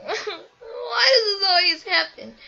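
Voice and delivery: high, slow whiny cry; mock wail. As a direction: wail at your own bad luck, hammed-up. Retold despair after injury.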